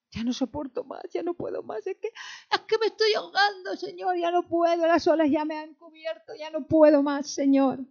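high, anguished mock cry to God; acted desperation, pitched high and swelling; desperate plea. Acts out drowning believer crying to God.

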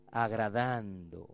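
low, slow answer word; low, slow cue word; slow call and response. Shown as a demonstration. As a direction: drag it out for the congregation. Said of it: "Diga conmigo".

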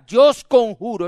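conjuro gritado, más fuerte; grito de conjuro. Shown as a demonstration. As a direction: lanza "Yo os conjuro" como un grito solemne. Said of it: "eso es un grito". (el predicador muestra el grito de la novia)

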